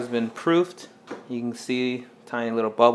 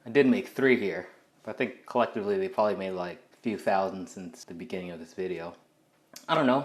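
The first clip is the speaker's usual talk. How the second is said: ordinary talk, nothing marked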